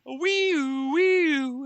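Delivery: high wee-oo siren; siren imitation. Reader imitating the planet's alarm siren. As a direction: wail it like a siren. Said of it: "you hear, like".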